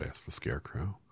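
ordinary delivery, nothing marked

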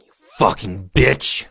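loud, forceful berating; shouted insult. Acted. Boss berating employee caught at work, loud and harsh. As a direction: burst out in a domineering shout.

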